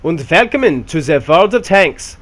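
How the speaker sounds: a cartoonish German tank commander greeting his crew; horrible German accent. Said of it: "my horrible German accent".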